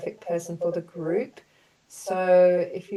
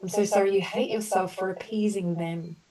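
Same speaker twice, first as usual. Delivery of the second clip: gentle, measured apology statements for the group; compassionate healing affirmations; 3 semitones higher, 3 dB louder than usual. Acted. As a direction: offer each sorry like a soothing balm. Leads group through healing apologies.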